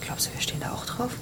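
worried, hushed question; a girl whispering a fearful question to her friend; anxious whisper. Acted. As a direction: whisper it, afraid to be overheard.

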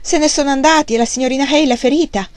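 shouting upstairs for mother; urgent shout. A narrator's reading. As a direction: hurry her down, alarmed. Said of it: "gridò".

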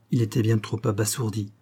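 ordinary delivery, nothing marked

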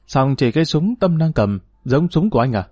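ordinary delivery

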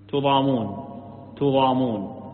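تضامون as tudamun, single m; u after t, light m. Shown as a demonstration. Teacher answers how it is read, تضامون with tu- and light m. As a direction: say تضامون with tu- and no doubling of the m. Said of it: "بضم التاء وتخفيف الميم".

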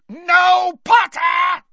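high, drawn-out furious scream; Snape. A narrator's reading. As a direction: scream to stop him at full force. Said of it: "screamed Snape".

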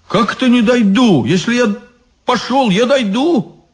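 high, protesting insistence; indignant. Acted. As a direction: push back, outraged.

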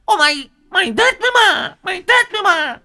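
jealous wife screaming threats with an axe; jealous furious screaming. Acted. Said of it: "Vừa đuổi vừa chửi".

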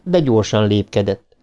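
ordinary delivery

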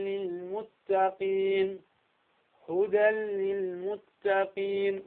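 هدى للمتقين with the tanween merged into the lam, no nasal; slow, smooth هدى للمتقين; merge without ghunna. Shown as a demonstration. Teacher demonstrating idgham without ghunna.

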